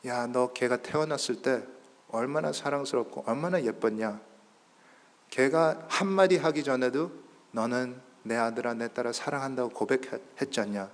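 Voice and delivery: soft, hushed, tender voice; gentle reminder. Acted. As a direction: remind a parent softly of their love. Voices the Lord gently reminding him. (조용하고 다정하게 일깨우는 말투)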